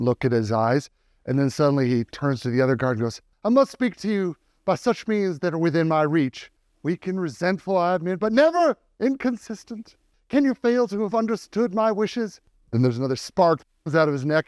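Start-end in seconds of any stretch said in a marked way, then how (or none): 3.4-12.4 high, formal period-drama voice; Jane Austen heroine. Acted. Possessed robot speaks to the other guard in a high, formal Regency voice. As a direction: recite it like a Regency lady. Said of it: "Jane Austen settings a little bit too high".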